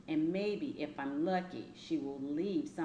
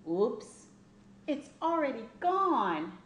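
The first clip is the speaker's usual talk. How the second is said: surprised and higher, louder; oops; 7 semitones higher, 5 dB louder than usual. A narrator's reading. Child narrator startled the tooth fell out. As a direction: blurt it out, caught off guard.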